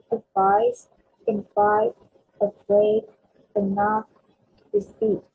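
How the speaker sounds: advice, invite, afraid, enough, receipt, each landing hard on its final syllable; second-syllable stress. Shown as a demonstration. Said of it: "we're stressing on the second syllable".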